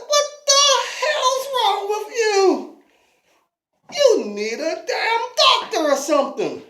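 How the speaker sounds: Davy yelling at the disgusting stranger, high and loud; disgusted yelling. Acted. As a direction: freak out at something gross.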